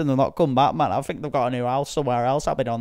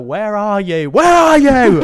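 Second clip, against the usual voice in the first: shouting higher and louder, building; calling through smoke; 8 semitones higher, 13 dB louder, slower than usual. Retold or imitated. Walking into the stoned family's house, building shout. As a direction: yell into a smoke-filled room. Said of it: "I'm like".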